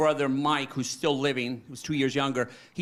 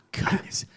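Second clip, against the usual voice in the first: weary sigh. Real, not acted. Overwhelmed thinking of dead brother.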